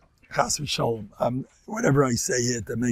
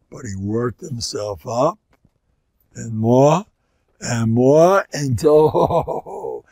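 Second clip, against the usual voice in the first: slow building intensity; 7 dB louder, much slower than usual. Retold or imitated. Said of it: "started off slow".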